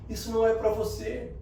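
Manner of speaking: normal talking voice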